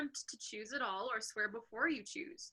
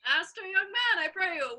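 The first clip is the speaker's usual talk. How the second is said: put-on calling out higher and louder, as an old father; old man calling for directions; old Gobbo; 7 semitones higher, 7 dB louder than usual. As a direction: hail a stranger insistently. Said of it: "Enter old Gobbo with a basket".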